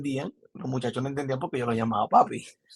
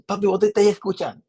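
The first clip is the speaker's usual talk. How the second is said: quick, higher and louder mock papi voice with voseo; imitating a friend; 7 semitones higher, 4 dB louder, faster than usual. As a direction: fire it off as a playful imitation. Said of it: "Entonces yo lo imitaba". (imitándolo rápido y más agudo: "papi, ¿vos te estáis escuchando?")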